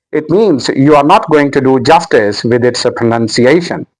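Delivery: normal talking voice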